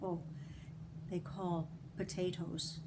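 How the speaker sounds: ordinary delivery, nothing marked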